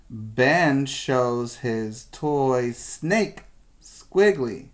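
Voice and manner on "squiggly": ordinary delivery, nothing marked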